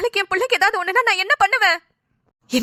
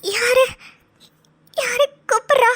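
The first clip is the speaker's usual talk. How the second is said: weak tearful waking voice; 5 semitones higher, slower than usual. Acted. Patient waking from coma.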